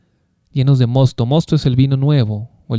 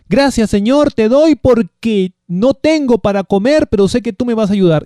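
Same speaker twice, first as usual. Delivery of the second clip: higher, louder mock prayer of thanks; fervent prayer imitation; 7 semitones higher, 4 dB louder than usual. Preacher imitating a poor believer thanking the Lord, fervent and raised. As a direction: voice a believer praising God in need.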